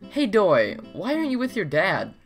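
normal talking voice

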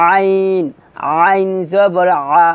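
আইন squeezed in mid-throat, drawn out; pressed ain. Shown as a demonstration. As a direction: slowly press out each আইন and আ. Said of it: "আইনটা চাপ দিয়ে বলতে হবে".

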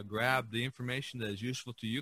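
ordinary talk, nothing marked

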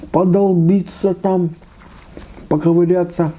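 high, drawn-out teasing relish; mock relish. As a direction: stretch it out, mocking the savoring. Imitating grandmother picking fish head.

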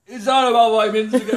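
laughing through the pain. Real, not acted.

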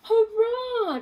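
high, loud happy cheer. Acted. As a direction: shout it with pure joy. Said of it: "cheered Plank".

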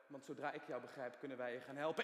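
usual speaking voice

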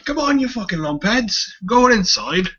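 put-on high, loud bossy child voice; bossy little boy. Big brother herding his siblings into the house, high bossy child voice. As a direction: boss the younger kids around like a bratty big brother.

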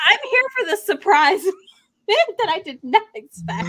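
high, laughing through it; laughing mock delight. Reader to friends, laughing at her surprise. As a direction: giggle your way through the line. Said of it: "I was like".

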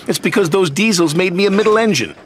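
high, plaintive excuse; sad protest. A narrator's reading. Percy blaming the diesels to Sir Topham Hatt, plaintively. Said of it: "Percy said sadly".

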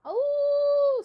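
long high howl, loud; dog howl. Acted. Dog howling over the preacher's sermon, long high howl. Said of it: "said Winn-Dixie".

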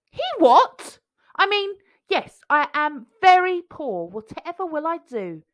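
high, eager little pea voice; squeaky small pea, caught out; Jack the pea voice. A narrator's reading.

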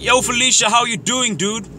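high, hearty hail to a pal; upbeat greeting. Acted. Man greeting an acquaintance at KFC, upbeat and high. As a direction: call it out high and friendly.

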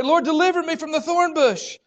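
a loud, high cry begging the Lord; desperate prayer. Pretend. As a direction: shout the plea as if at the end of your rope.

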